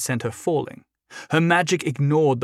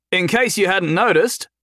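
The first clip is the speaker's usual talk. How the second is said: calling out mockingly; 6 semitones higher, 4 dB louder than usual. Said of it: "Jason called out to him".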